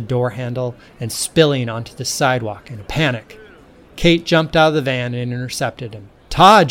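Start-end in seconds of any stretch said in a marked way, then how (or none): 6.3-6.7 sharp loud stern call of his name, higher; stern yell. A narrator's reading. Kate stopping Todd running off, stern loud yell. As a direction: yell his name to stop him cold. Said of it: "she yelled sternly".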